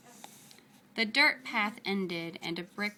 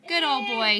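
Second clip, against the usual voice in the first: sad; 3 semitones higher, 8 dB louder than usual. Acted. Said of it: "said Alan sadly".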